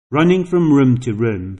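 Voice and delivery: ordinary delivery, nothing marked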